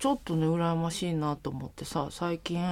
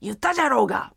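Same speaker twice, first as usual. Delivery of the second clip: louder, harsher Hiroshima "言ったじゃろうが"; Hiroshima dialect; 3 semitones higher, 7 dB louder, faster than usual. Shown as a demonstration. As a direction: bark it Hiroshima style. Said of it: "こんだけ違うんだよな".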